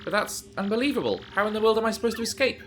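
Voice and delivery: a hint of a shocked princess; alarmed princess. Player voicing Peach hearing she's on the moon, mildly alarmed.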